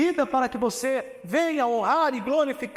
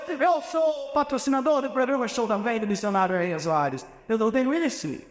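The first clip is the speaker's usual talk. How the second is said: mock envious whine, higher and rushed; envious complaint; 6 semitones higher than usual. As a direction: act out a jealous believer grumbling at others' success. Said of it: "E você diz assim".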